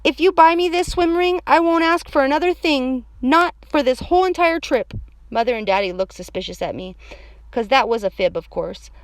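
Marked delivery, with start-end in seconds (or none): 0.0-4.9 put-on loud, high child's bargaining; insistent high kid voice; pleading little girl. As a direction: wheedle like an eager kid.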